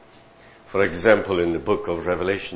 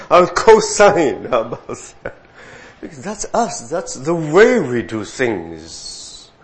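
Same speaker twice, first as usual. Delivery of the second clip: laughing mockery; 8 semitones higher, 9 dB louder than usual. Mocking elders' way, laughing.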